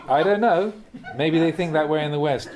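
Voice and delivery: amused chuckling speech; laughing. Real, not acted. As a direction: keep laughing as you talk. Still laughing after the joke.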